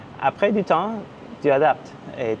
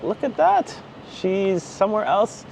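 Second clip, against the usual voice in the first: amazed onlookers; 7 semitones higher, slower than usual. Retold or imitated. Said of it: "people from her country would be like".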